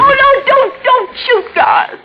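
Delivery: high panicked plea at gunpoint; terrified begging. Acted. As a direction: beg him not to shoot. Garth aims a gun at her.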